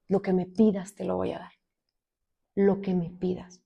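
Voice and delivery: soft, low vow to God; retold prayer of surrender, barely voiced; hushed devotion. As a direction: say it very quietly and low, slowing at the end. Retells her surrender to God.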